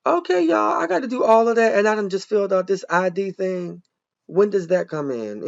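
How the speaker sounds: loud, high, fed-up complaint to the viewers; exasperated outburst. Real, not acted. To her viewers, annoyed at refilling the address form, loud exasperated outburst. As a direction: throw up your hands at all this paperwork, pitched high and loud.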